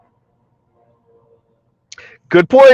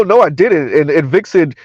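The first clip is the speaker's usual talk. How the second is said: ordinary talk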